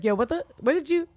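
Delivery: high, loud, sputtering disbelief; hammed-up indignation, stumbling over the question; mock outraged. Husband confronting wife about dress, mock outrage.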